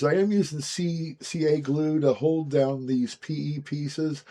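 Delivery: usual speaking voice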